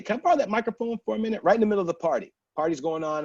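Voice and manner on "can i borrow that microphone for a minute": fast, higher request; quick ask. Retold or imitated. Asking the DJ for the mic, fast. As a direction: rattle it off eagerly. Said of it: "I'm like".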